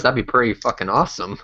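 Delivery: normal talking voice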